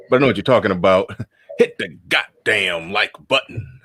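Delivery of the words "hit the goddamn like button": imperfect impersonation, urging viewers to subscribe; X impression. Podcast host plugging the like button, doing an X impression. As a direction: do your best impersonation, not quite nailed. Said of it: "That was my best X impression".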